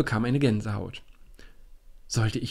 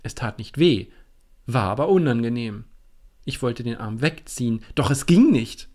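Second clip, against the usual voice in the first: ordinary delivery, nothing marked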